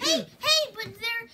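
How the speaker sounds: high, loud frantic calls. Pretend. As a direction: cut in loudly. Frantic interruption.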